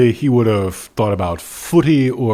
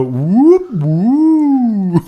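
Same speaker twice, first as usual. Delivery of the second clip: high rising whoop then falling woo; slide whistle whoop; 9 semitones higher than usual. Pretend.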